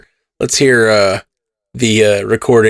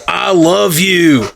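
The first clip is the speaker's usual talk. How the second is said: high, slow, drawn-out Scottish growl; angry Scottish accent; 7 semitones higher, much slower than usual. Shown as a demonstration. Stand-up bit on angry Scots, mock Scottish accent. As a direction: make affection sound furious in mock Scottish. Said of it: "And the Scottish can be like".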